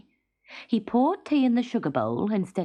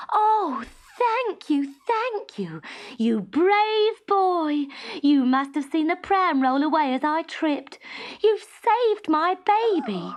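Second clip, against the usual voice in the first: high, breathless grateful woman; gushing young mother; 9 semitones higher, 4 dB louder, slower than usual. A narrator's reading. Young mother thanking Teddy for saving her baby, gushing. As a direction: pour out thanks almost in tears.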